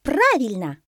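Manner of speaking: delighted monkey, high and loud. A narrator's reading. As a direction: cry out gladly in agreement. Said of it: "обрадовалась мартышка". (радостно, высоко и громко)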